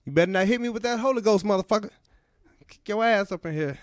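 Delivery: high, fast mock Jackson warning; Samuel L. Jackson. Comic voicing the actor warning shouting churchgoers, high and fast. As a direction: rattle off the threat up high.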